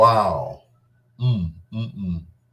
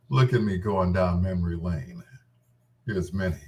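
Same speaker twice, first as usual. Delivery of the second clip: usual speaking voice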